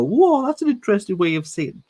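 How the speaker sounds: high, loud, quick mock amazement. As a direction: play the gushing listener. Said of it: "people go".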